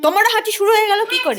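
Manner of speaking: high, quick, mock-surprised question to a little one. Real, not acted. Parent asking a child about sneezing, in playful baby talk. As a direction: coo it high and fast, teasingly.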